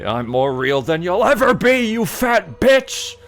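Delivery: high, loud, drawn-out hammed yell; hammed-up angry screech; furious shout. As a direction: scream it at mom in a rage. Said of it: "You say".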